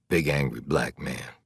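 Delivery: plain reading voice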